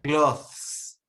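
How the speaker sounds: clothes said as kloth with a hard th; like an A one student, Clothes ending in th; mispronounced student style. Pretend. Playing a beginner student, Clothes mispronounced as kloth. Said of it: "How would your students, A one students".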